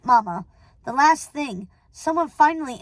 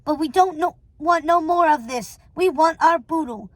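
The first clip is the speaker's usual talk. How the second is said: higher, insistent crook's voice; pushy, raised-pitch complaint; demanding henchman; 4 semitones higher, 4 dB louder than usual. Acted. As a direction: demand your share like a henchman.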